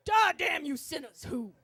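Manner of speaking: loud imitated preacher; preacher launching into a condemnation; sermon voice. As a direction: boom a damnation at the sinners. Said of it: "continues his sermon".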